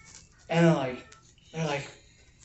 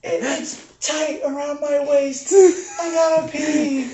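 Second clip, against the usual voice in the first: much higher, louder, slow pleading whine; whining kid; 11 semitones higher, 8 dB louder, slower than usual. Retold or imitated. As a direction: drag it out like an uncomfortable kid. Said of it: "And he's like".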